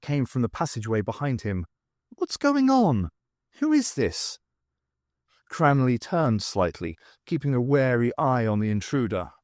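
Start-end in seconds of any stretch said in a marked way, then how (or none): 2.1-4.4 much higher voice, puzzled questions; high-pitched character voice, querying; smooth, familiar voice. A narrator's reading. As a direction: demand an explanation in a high, smooth voice. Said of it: "smooth and familiar".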